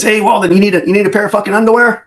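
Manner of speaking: high teasing jab, a bit fast; mocking boss. Boss razzing his officer at briefing, high mocking tease. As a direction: needle him like a crude boss.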